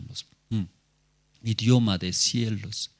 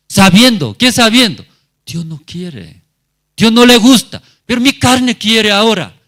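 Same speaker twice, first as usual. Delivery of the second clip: fiery preaching climax; 11 semitones higher, 10 dB louder than usual. Acted. Preacher builds to emphatic climax.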